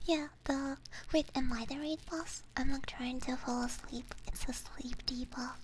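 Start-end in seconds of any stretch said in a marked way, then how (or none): none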